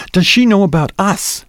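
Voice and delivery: high, pressing question with a touch of jealousy; insistent, tinge of jealousy. A narrator's reading. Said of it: "more insistent now, with a tinge of jealousy".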